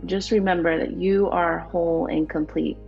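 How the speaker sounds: ordinary talk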